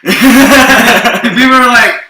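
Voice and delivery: words squeezed out through laughter, high and slow; giggling lead-in; laughing. Real, not acted. Laughing while speaking.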